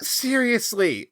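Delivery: ordinary talk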